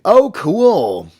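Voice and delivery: high, loud bright-eyed oh cool; excited reaction. Real, not acted. Chat reaction to viewer comment.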